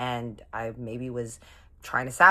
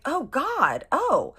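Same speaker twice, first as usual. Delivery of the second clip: high-pitched, loud dawning horror; dismayed shock; 13 semitones higher, 6 dB louder, much slower than usual. Retold or imitated. Flirt finds out he is engaged, dismayed shock. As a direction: exclaim it high as the guilt hits. Reacting to news of a fiance.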